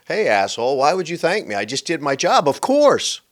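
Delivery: mock indignation; indignant retort. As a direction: act out an affronted comeback, rising to a high punch. Acting out indignant reply to thanks.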